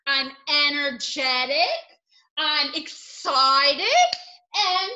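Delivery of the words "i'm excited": slow, drawn-out excitement; excited, lingering on each syllable. Shown as a demonstration. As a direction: stretch out the word excited. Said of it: "speaking of emotions".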